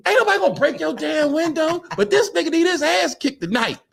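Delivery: high-pitched indignant comeback; acted angry retort. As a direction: argue back heatedly, in imitation. Fighter yells back at door.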